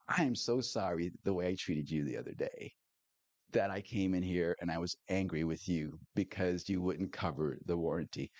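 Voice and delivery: humble apology. Retold or imitated. Churchgoer apologizing to the tire shop owner, soft and humble. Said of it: "I, I humbled myself".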